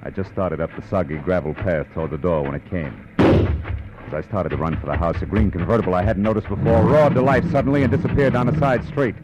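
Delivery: calm detective narration; story narration. A narrator's reading. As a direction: tell it like a weary private eye looking back. Detective narrates over music.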